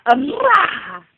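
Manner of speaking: loud, high peekaboo cry; playful peekaboo shout. Acted. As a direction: pop out and shout it to the baby. Peekaboo game with baby.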